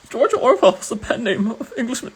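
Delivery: very high, loud put-on voice; Deku. Streamer trying out a viewer's accent request, mock Deku. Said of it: "Read it in a Deku accent".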